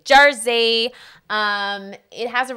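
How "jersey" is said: Jersey said louder, stressed; Jersey, hammed up; emphatic Jersey. Shown as a demonstration. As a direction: punch out Jersey. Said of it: "know anything about New Jersey".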